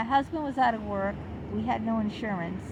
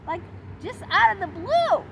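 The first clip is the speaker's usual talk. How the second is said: higher, louder disbelief; indignant; 9 semitones higher, 6 dB louder than usual. Real, not acted. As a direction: push "blue" up in outrage. Indignant over odd question.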